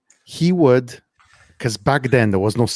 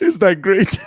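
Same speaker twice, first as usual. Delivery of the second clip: usual speaking voice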